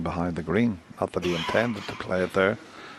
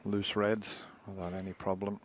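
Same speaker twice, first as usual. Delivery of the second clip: soft live snooker commentary; hushed commentary; 3 dB quieter than usual. Real, not acted. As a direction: murmur the play-by-play.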